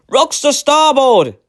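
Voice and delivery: slow, high, loud warning cry; lookout's cry. A narrator's reading. Lookout warns the helmsman of rocks, slow loud cry. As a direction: sing it out from the masthead. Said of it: "cried the lookout".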